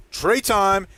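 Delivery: loud, high hype holler; imitated fan yell. Imitating fans as Trey Lance comes in, loud cheering yell. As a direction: cheer it from the bleachers. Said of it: "three or four people I heard yelling".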